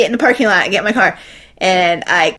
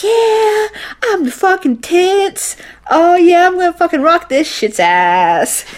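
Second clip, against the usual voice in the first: cocky hype; 8 semitones higher than usual. Retold or imitated. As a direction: hype yourself up before the big test. Pumped-up self-talk before the test.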